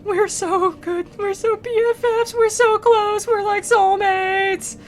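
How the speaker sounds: high, stretched, swooning voice; mock-gushing Dante. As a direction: gush about your best friend like a smitten kid. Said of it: "And, and he's like".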